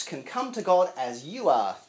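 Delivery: normal talking voice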